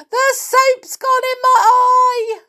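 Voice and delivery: high, loud yell; yelling in pain. A narrator's reading. Amelia yelling at Tom as soap stings her eye. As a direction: cry out, stung. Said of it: "yelled Amelia Jane".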